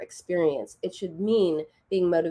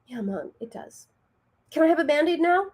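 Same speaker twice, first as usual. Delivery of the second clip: put-on small childlike voice, rising high; calmed-down child; 8 semitones higher than usual. Daughter done crying asks mom, imitated little voice. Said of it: "She stops crying immediately, and she's like".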